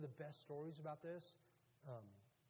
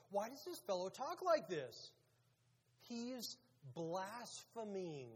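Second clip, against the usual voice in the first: indignant; 4 semitones higher, 5 dB louder, slower than usual. Acted. Indignant teachers thinking to themselves.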